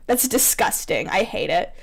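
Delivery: ordinary talk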